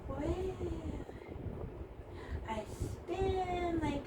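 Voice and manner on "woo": gasp and woo. Shown as a demonstration. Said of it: "touch the trunk and go".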